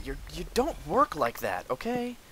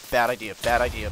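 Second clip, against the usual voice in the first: loud warning; 9 dB louder than usual. Real, not acted. Repeated warning.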